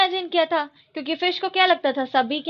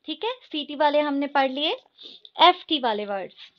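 ordinary talk, nothing marked

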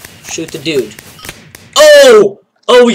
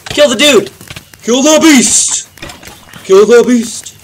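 high, loud rallying shout; battle cry; 7 semitones higher, 6 dB louder than usual. Acted. Gamer rallies his troops with a loud battle cry. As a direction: yell them into the fight.

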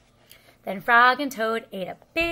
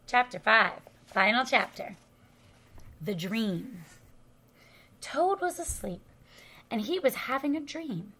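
ordinary delivery

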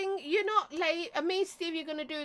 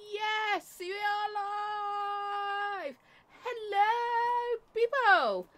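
excited greeting; 6 semitones higher, much slower than usual. Real, not acted. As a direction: greet the viewers brightly. Streamer greets viewers going live.

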